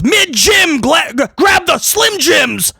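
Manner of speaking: high shouted announcer call; hammed-up commentator shout; mock Corey Graves yell. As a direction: holler it like a hyped commentator. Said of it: "Corey Graves yells".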